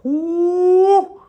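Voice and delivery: high, loud, drawn-out whoo; loud whoop. Real, not acted. Streamer breaking off his slow instruction, high excited whoop. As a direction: let out a long high whoop.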